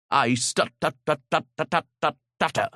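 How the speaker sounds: stuttering on the t, repeating tut tut tut. Shown as a demonstration. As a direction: demonstrate a stammer, stuck on the first syllable. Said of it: "I stutter".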